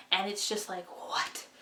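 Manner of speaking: breathless, airy what; winded after talking fast; out of breath. Real, not acted. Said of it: "I'm out of breath".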